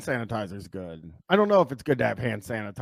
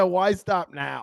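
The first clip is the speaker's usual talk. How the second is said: higher, slow teasing copy of Tom; mimicking Tom; 6 semitones higher, slower than usual. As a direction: echo his line back, mocking and slow. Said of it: "He said".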